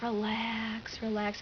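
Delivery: drawn-out, calming command; soothing hypnotist imitation. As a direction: imitate a hypnotist lulling people to sleep. Retold hypnotist putting them to sleep.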